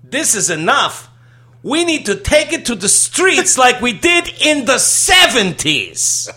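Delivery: fed-up actress impression, high and slow; Carol Kane. Host quotes a fed-up sitcom character, high mock protest. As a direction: draw it out in a loud, high-pitched mimicked voice. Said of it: "Carol Kane says she's fed up".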